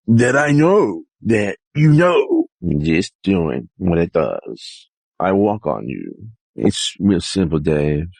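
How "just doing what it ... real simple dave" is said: low character voice. Acted. Low put-on character voice bits.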